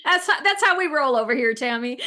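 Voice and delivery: high, loud, through real laughter; laughing. Real, not acted. Guest joking to host about how we roll, laughing. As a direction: say it while cracking up.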